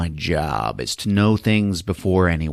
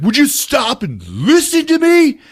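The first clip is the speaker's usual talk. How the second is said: much higher, loud call after her; mock pleading shout; 6 dB louder than usual. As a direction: yell after her to stop. Dolph chases Diane.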